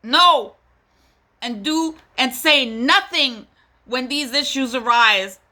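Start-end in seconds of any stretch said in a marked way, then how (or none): 0.0-0.6 louder and higher, cutting it off; emphatic refusal. Real, not acted. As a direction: shut the excuse down hard. Rejects mocked excuse loudly.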